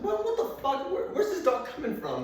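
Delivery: high-pitched, half-laughing disbelief; acted-out shrill astonishment; baffled outburst. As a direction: squeal it out in comic bewilderment. Acts out bafflement at strange pit bull.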